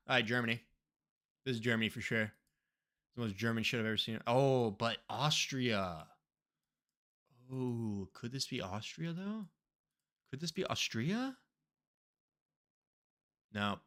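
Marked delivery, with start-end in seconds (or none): none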